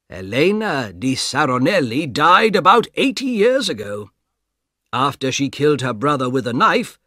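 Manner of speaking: put-on the old guard telling what he knows; calm guard voice. Guard tells her fate.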